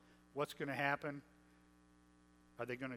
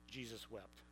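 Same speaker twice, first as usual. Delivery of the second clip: hushed reverent reading; 6 semitones lower, 12 dB quieter than usual. Shown as a demonstration. Preacher reading the shortest verse, hushed and low. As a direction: drop to a near hush on Jesus wept. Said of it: "memorized a verse".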